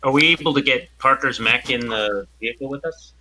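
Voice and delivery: ordinary delivery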